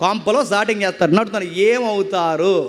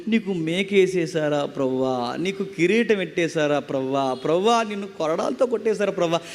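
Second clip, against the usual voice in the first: mock sentimental lament; 7 semitones lower, 5 dB quieter than usual. Devotee lamenting Christ's wounds, mock sorrowful and soft. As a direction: imitate a weepy devotee pitying the Lord. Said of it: "ఇలా actor లాగా యేసుక్రీస్తుని ఊహించుకుని ఏడవద్దు".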